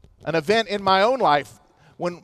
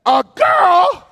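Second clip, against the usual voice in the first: higher and louder, stunned rising question; shocked question; 8 semitones higher, 6 dB louder than usual. Retold or imitated. Emily hearing the doctor's news, shocked disbelief. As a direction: total disbelief in two words.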